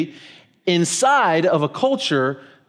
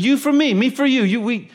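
put-on insistent little kid; 8 semitones higher than usual. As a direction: mimic the eager boy. Retelling the kid's phrase.

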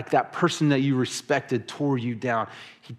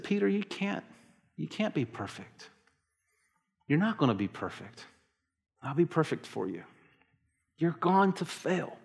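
softer, gentle reassurance to Peter; tender Jesus voice; 4 semitones higher, 6 dB quieter than usual. Retold or imitated. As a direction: speak it like a loving master comforting a friend.

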